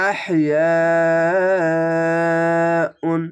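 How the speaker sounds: আহিয়াউন chanted very slowly, long vowel held four beats; Quranic recitation. Shown as a demonstration. Teacher models the four-alif stretch, slow chant. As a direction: sustain আহিয়াউন like a muezzin. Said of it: "মোটা চিহ্ন মাদ্দে লাজিম চার আলিফ টেনে পড়বেন".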